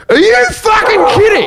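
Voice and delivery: very high, incredulous shout; retold outraged yell. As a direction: re-enact a scandalized outburst at full pitch. Acts his outburst, dogs start barking.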